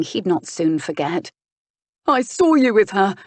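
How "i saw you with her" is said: higher, louder angry blurt; shot out. Acted. Sprawled nephew hurls accusation up at his uncle, sharp angry blurt. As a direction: fire the words out in defiance. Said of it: "Winterton shot out".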